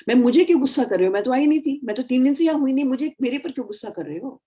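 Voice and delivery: a staffer pleading she wasn't even there; defensive whining protest. Acted. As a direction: protest being blamed, rattled and hurt. Employee protesting unfair scolding.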